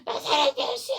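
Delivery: very high, strained yell; all-out cry. Retold or imitated. Blind beggar calling to Jesus, all-out cry. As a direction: cry out at the top of your range. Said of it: "소리를 있는 대로".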